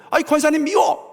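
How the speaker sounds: high-pitched, acted spite; mock petulant complaint. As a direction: whine it out like a sulky church member. Mimics someone spitefully saying dislike. (밉다고 투정하는 높은 목소리)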